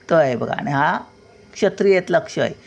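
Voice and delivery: normal talking voice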